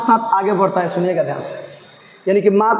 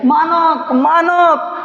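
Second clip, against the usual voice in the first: high calling cry to her son; 6 semitones higher, much slower than usual. Acted. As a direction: call out to the son in a dream. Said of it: "बिस्नी उसी तरह चिल्लाती है". (ऊँची आवाज़ में बेटे को पुकारना)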